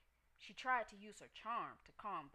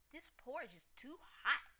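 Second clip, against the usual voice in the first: complaining; 6 semitones higher, 5 dB louder than usual. A narrator's reading. Goldilocks complains about hot porridge.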